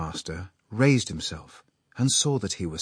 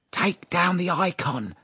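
high, slow, voiced stage whisper; excited urging; 10 semitones higher, 3 dB louder than usual. A narrator's reading. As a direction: urge your wife on with suppressed excitement. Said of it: "Peplov whispered, pale with excitement".

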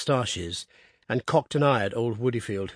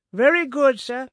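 servant's voice; 13 semitones higher, 7 dB louder than usual. A narrator's reading. Servant's reply.

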